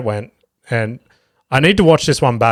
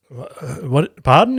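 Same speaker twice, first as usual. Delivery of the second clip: hammed-up disbelief; mock bafflement; 3 semitones higher than usual. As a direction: play it confused, jumping up in pitch on "Pardon". Comic baffled by heckler request.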